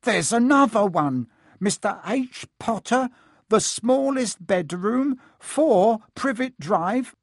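high excited yell; shouting. Acted. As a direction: call out the news, slowing at the end. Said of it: "and then he shouted".

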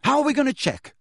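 high, exasperated question; incredulous demand. A narrator's reading. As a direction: challenge them impatiently. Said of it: "Harry demanded".

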